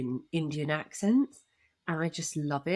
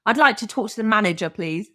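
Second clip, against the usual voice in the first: mock [name] accent; 2 semitones higher, 7 dB louder than usual. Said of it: "my [name] accent is this".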